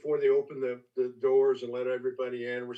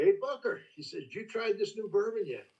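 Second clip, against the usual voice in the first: high, teasing call; teasing Jimmy; 8 semitones higher than usual. Retold or imitated. Jimmy teasing Booker about the new bourbon, high playful call. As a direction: needle an old friend, pitched up.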